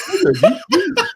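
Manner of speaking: put-on high, laughing girl's reply; mock girl on date. Mocks a girl on a show's first date, high and giddy. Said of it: "she's like".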